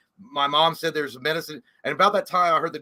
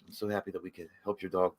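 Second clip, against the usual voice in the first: low, soothing sales patter; smooth vet staffer; 9 semitones lower, 3 dB quieter than usual. Acted. Vet staff easing owners into the bill, smooth and low. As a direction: break bad news softly and smoothly, pitched down. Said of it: "they come out to you, like, smooth".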